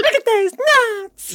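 high, squeaky chipmunk voice; a cartoon chipmunk spotting nuts. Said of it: "Do the whole thing in chipmunk voices".

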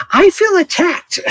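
high-pitched mock hurt cry; mock wounded. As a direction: squeal it as if personally attacked. Playing the targeted Bob.

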